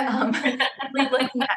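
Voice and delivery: talking through a light laugh. Real, not acted. Host joking about the pandemic, laughing through words. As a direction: let the words ride on a chuckle.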